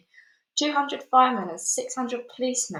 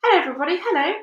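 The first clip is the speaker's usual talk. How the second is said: high, loud happy greeting; cheerful calling out; 8 semitones higher, 7 dB louder, much faster than usual. A narrator's reading. Boy on the peach calls down to everyone, delighted. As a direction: wave and call down to the crowd. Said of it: "laughing and waving, calling out".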